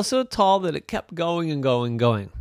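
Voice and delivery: ordinary delivery, nothing marked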